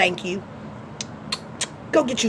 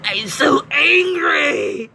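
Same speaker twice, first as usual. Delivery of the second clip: higher, slow, hammed-up angry shout with a laugh; mock angry voice; 5 semitones higher, slower than usual. Mock angry voice for the viewers. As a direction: fake a furious whine. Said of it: "In my angry voice".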